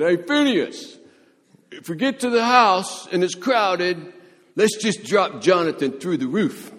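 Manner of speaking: loud, high-pitched buddy hollering; excited calling out. Acted. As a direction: holler a wild plan to your pal. Imagined friend calling out a plan.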